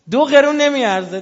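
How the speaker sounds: high, loud preacher's cry; emphatic shout. Real, not acted. Preacher scorning prayer without allegiance, loud high cry. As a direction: fling the verdict at the crowd.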